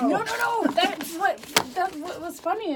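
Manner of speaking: laughing protest; excited. Real, not acted. Mother correcting the host excitedly.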